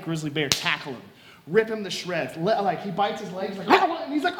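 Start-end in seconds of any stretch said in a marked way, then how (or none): 3.7-4.0 sudden high cry of pain; short, loud acted yell. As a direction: yelp as if bitten. Acting man bitten by bear.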